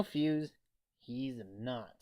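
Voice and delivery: ordinary delivery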